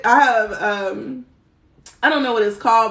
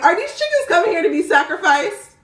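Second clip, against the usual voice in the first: worried question; 8 semitones higher, 3 dB louder than usual. Retold or imitated. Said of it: "I'm like".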